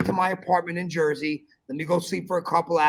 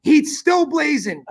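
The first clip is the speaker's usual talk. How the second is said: emphatic; 8 semitones higher, 7 dB louder, slower than usual. Real, not acted. Answering friend's question emphatically.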